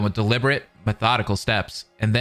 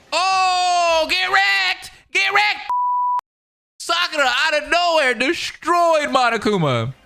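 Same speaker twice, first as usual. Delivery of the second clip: very high-pitched gloating yell; real squealing hype, drawn out at the end; hyped cheer; much slower than usual. Real, not acted. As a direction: cheer like a fan at a knockout. Hype reaction to the rescue.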